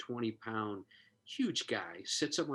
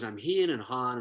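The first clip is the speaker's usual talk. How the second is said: ordinary talk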